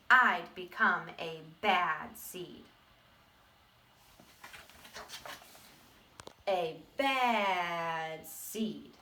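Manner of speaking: very slow, heavy "A bad seed"; drawn-out menace. Teacher reading to kids, dragging out the seed's name slowly. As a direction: stretch every word of "A bad seed" like a villain.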